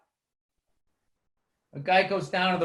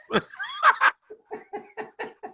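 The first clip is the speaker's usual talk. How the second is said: high burst of laughter tapering off; real high-pitched laugh. Real, not acted. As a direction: crack up at the joke. Laughing at own bus joke.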